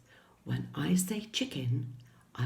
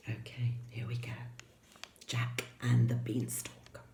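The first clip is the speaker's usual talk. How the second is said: hushed storyteller whisper; 4 semitones lower, 6 dB quieter than usual. Acted. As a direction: whisper it like a secret.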